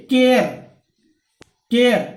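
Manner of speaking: केयर said loudly with an ए gliding into an आ; air sound demo. Shown as a demonstration. Teacher showing students how to say केयर. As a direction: stress the glide from ए to आ in केयर. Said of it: "ए और आ मिलके केयर बन रहा है".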